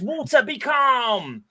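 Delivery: high, drawn-out incantation; spell-casting witch. Acted. Teenage witch calming the water, high slow incantation. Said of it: "And she says".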